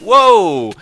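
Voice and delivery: sudden loud whoa; real surprise yelp; startled whoa. Real, not acted. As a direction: caught off guard by the boost. Rocket boost launches him.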